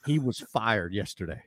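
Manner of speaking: low Southern drawl; Leslie Pardue. Retold or imitated. Program director tells producer of a firing, low Southern drawl. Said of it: "great Southern drawl".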